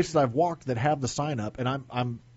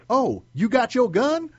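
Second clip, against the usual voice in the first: drawn-out, higher and louder retold yell; mock yell; 9 semitones higher, 5 dB louder, slower than usual. Other guard blurting out to the officer in line, imitated yell. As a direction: imitate someone loudly calling out in disbelief, stretched slowly. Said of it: "And, like, yelled it".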